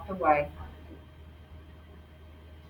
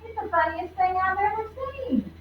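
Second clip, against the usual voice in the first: high, louder gleeful call; excited yell; 9 semitones higher, 5 dB louder than usual. Acted. Tony calling out about Ralph stuck, gleeful. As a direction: shout it like the funniest thing ever. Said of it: "Tony yelled".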